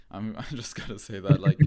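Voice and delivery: laughing through the words. Real, not acted. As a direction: chuckle while admitting it. Laughing at own admission.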